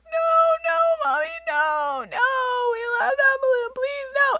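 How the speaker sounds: put-on very high, crying little kids begging; pleading kids. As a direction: imitate small children wailing for their balloon. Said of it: "the kids are like".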